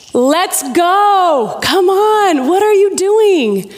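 impatient urging. Acted. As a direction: hurry them up in mock exasperation. Acting out impatience at the story.

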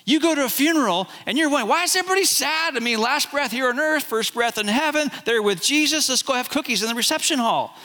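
breezy, high, unbothered mock voice; chirpy mock of a callous churchgoer; mock numb churchgoer. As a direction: play the cheerful unfeeling believer. Mocking emotionally numb churchgoer.

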